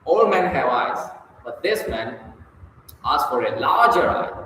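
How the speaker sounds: LARGER given strong weight; stress on LARGER. Shown as a demonstration. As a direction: bring out "larger" as the new idea. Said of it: "larger is emphatic".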